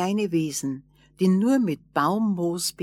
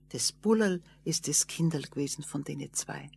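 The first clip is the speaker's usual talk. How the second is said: usual speaking voice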